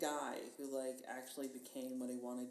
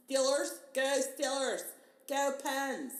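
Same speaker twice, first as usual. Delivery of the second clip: loud, high Pittsburgh fan cheer; bad Pittsburgh accent; 10 semitones higher, 10 dB louder, slower than usual. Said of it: "very bad interpretation of a Pittsburgh accent".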